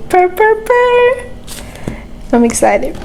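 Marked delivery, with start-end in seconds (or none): 0.1-1.3 high rising cat purr; playful purr. Pretend. Purring over the spooky book.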